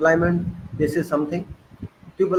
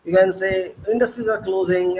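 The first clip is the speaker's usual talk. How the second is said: usual speaking voice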